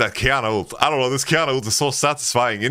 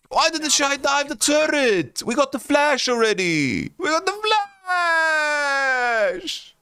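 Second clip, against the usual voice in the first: high frustrated rant; 10 semitones higher, slower than usual. Real, not acted. Fan rants at team's misplays.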